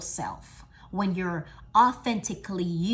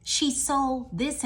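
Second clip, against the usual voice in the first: mock awe; 6 semitones higher than usual. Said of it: "They're gonna be like".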